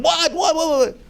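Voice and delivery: high, rapid stunned whats; wife's stunned repeated what; shocked what. Retold or imitated. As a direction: gasp out each what in disbelief. Wife shocked, eyes wide.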